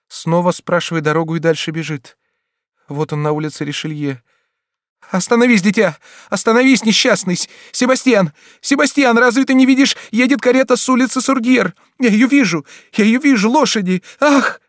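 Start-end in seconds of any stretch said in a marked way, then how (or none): 5.1-14.6 put-on high, loud frantic cries to a child; panicked screaming. Mother screams at her son before the horses, panicked. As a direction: shout a warning in terror.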